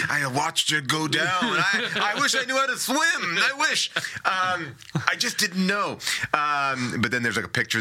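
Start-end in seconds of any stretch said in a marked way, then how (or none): 0.1-6.0 high-pitched, hammed-up grief; comic pleading regret; mock lament. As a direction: wail as the bereaved old man, badly faked. Plays widowed old man lamenting drowning.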